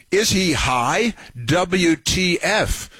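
host parodying a reporter's tweet on air, slow scornful mockery; mock incredulous quote. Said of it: "she says".

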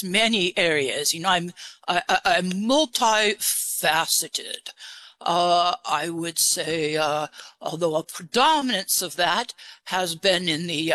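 put-on disguise the voice with an English accent. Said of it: "Where did you get your English accent?".

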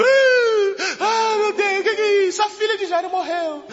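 high, far-off mock wail with sobbing laughter; hammed-up mourners' wail; imitating wailing mourners. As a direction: play the crying household in a high lament. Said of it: "tá todo mundo chorando".

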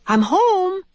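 high, lifted call home. Acted. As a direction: call out to the family down the hall. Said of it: "called Missus Frisbee".

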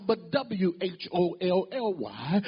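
letter by letter, slow and deliberate, W H O L L Y; spelled letter by letter. Shown as a demonstration. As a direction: spell out W H O L L Y slowly, one letter at a time. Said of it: "not H O L Y".

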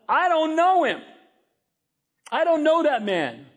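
high-pitched, emphatic denial. Acted. Peter disowns Jesus to the girl, insistent denial. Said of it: "he gets even more personal".

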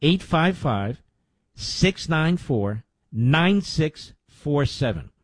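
slow digits in spaced groups, pausing between each; slow dictation. Shown as a demonstration. Host repeating the donation number for listeners, slowly. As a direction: read the number out slowly for note-taking. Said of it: "Let me say that slowly".

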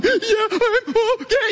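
very high, drawn-out squeal of exaggerated crying; hammed-up weepy wail; mock bawling. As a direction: act too choked with sobs to talk. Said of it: "can't even talk type crying".

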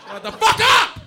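very high, loud shriek; comic scream. Acted. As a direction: yell it at full volume. Yelling over the whining.

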